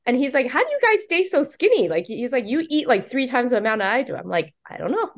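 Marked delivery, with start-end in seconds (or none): 0.5-4.2 high, incredulous mock voice; amazed husband. Author mimicking her husband's high amazement at how much everyone eats. 4.7-5.1 high, light mock innocence; innocent shrug. Author answering her husband's question with a high shrug.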